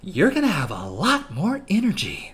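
lightly put-on pitchman, punching the tagline; Ryan Reynolds. Joke pin advert to viewers, lightly mimicked celebrity pitchman. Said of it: "how Ryan Reynolds would do it if he was doing an ad".

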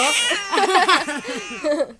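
real, unrestrained giggling; long peal of laughter; burst of laughter. Real, not acted. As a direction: crack up laughing.

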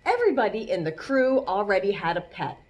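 ordinary delivery

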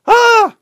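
high, loud hysterical shriek; mock shriek. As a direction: shriek as if you ran outside. Mocking panicked journalists.

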